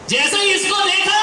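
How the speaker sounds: sudden high shout of alarm; shocked yell at her return; outraged shout. Retold or imitated. Mother-in-law sees daughter-in-law arrive.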